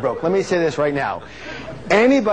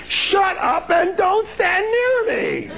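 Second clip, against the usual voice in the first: very high, drawn-out comic cry; hammed-up shriek; slower than usual. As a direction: mock-desperate shout to back off. Acted comeback to the complainer.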